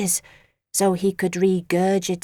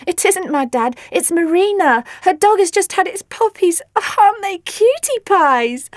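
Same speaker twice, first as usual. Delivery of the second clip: gushing; 8 semitones higher, 6 dB louder than usual. Acted. Gushing over puppies.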